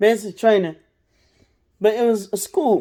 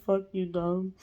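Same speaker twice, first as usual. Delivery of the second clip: muttered under breath; 3 semitones lower, 12 dB quieter, slower than usual. Retold or imitated. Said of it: "in your head, you like".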